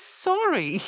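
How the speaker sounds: high, singsong little sorry; meek mock apology. Queen apologising to livid guardian, high meek squeak.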